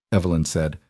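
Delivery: usual speaking voice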